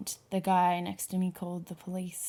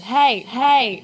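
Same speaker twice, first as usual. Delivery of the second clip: loud, high shout to intervene; 5 semitones higher, 12 dB louder than usual. Retold or imitated. Witness calls out to an attacker on a train, shouting. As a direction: shout out to stop someone. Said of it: "And then I shouted".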